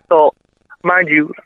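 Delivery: usual speaking voice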